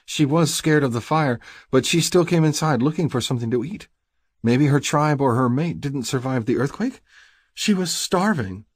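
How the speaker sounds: high-pitched sympathetic female character; pleading woman. A narrator's reading. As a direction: argue for the creature in a high voice. Stacy defends the creature.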